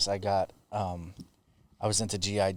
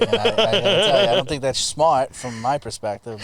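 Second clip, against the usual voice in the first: higher, louder, laughing through it; mock-serious, cracking up; 4 semitones higher, 7 dB louder than usual. Real, not acted. Mock-serious war talk to dad.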